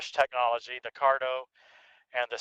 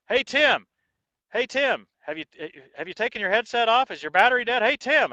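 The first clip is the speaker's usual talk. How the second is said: higher, louder mock calls of teammates; imitated yelling; 8 semitones higher, 5 dB louder than usual. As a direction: call out to Tim like worried riders on the radio. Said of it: "they yell louder and louder".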